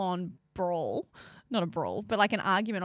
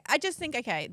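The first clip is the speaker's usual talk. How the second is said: usual speaking voice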